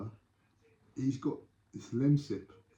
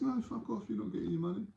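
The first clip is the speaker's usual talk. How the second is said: high, softer dismissive brush-off; acted refusal; 10 semitones higher, 6 dB quieter than usual. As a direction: play the seller refusing in a squeezed-up pitch. Acted refund quarrel, seller refuses.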